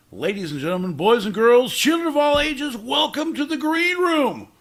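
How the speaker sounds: booming ringmaster announcer, rising; grand entrance announcement. Acted. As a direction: build it up like a circus ringmaster. Announcer welcoming to green room.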